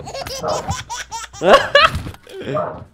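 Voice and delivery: long high giggling fit; laughing. Real, not acted. Player playing dumb over loot he took, high laughing.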